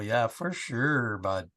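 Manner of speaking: deep-woods East Coast drawl; East Coast accent. Acted. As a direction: drag it out like an East Coast backwoods local. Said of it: "did you speak like that".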